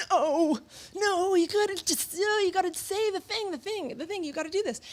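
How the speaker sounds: high, urging inner voice. Retold or imitated. Inner voice urging her to ask for a gallop, high and pushy. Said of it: "that voice, that thing was like".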